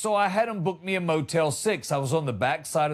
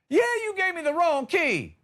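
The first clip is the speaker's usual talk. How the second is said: much higher, incredulous comeback; indignant retort; 11 semitones higher than usual. Acted. Guest confronting manager over wrong key, high indignant retort. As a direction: throw it back high and exasperated.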